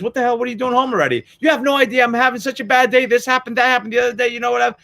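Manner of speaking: a spouse fight building to shouting; mock marital fight. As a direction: bicker like a couple blowing up. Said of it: "your wife or your husband says".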